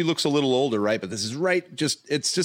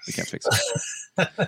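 mock whisper; 7 semitones higher, slower than usual. Joking about display.

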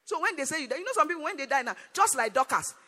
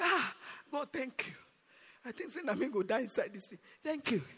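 sighing, acted tearful relief; mock crying. Crying spouse thanking God after escaping, acted tearful. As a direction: pretend to weep with a long sighed "ah". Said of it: "Then he's crying".

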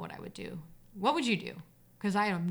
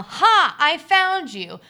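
loud, raised-pitch narrated yell; triumphant yell; 6 semitones higher, 13 dB louder, much slower than usual. A narrator's reading. Child who spots a figure on the stairs, yelling. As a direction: yell it out, stretching the words. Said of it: "I yell".

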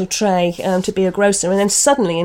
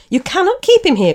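higher, louder protest from Lizzie; cried out; 10 semitones higher, 7 dB louder than usual. Acted. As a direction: cry it out as an alarmed wife. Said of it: "cried Lizzie".

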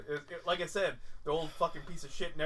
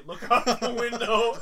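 slow, raised call; 5 semitones higher, 7 dB louder, slower than usual. Retold or imitated. Drone owner telling neighbor to look outside, calling out. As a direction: draw it out loud and high.

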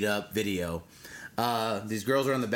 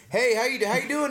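higher, louder eager reporter greeting; 12 semitones higher, 5 dB louder than usual. Retold or imitated. Paparazzo greeting [name] off the plane, in a higher, louder eager voice. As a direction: play the overly friendly paparazzo. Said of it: "T M Z guy's like".

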